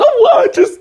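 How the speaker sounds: high blubbering imitation sob; fake-cry through the excuse; mock crying. Cheater confessing to partner, mock crying. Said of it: "and crying".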